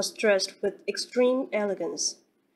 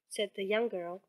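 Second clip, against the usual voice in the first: ordinary delivery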